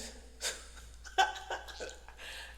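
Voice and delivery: voiceless chuckle; a run of breathy laughs, hardly voiced; laughing. Storyteller showing their inward glee at her freaking out, laughing. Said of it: "inside I'm laughing".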